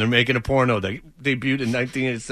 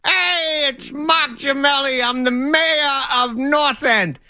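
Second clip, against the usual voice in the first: much higher, slow mock Boston accent; 11 semitones higher, slower than usual. Impersonating Nick's dad calling in, in a Boston accent. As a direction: ham up a Boston mayor, drawn out. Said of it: "You go".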